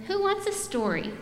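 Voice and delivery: high, sweet mom offering a story; mommy voice. Acted. Mom coaxing her sleepy daughter, high and sweet. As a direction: lift it up like a coaxing mother. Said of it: "Mommy said".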